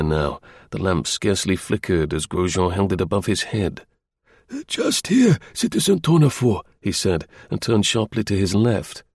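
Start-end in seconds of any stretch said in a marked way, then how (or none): 4.7-6.6 put-on high old voice pointing the way; old concierge showing the spot; helpful old man. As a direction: guide him like an old doorman. Grosjean guides with lamp.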